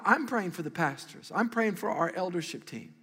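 usual speaking voice

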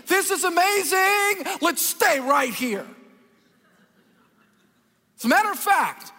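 high, slow and loud with wonder; excited Peter. Acted. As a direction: gush over it like an overeager disciple. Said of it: "here's what Peter says".

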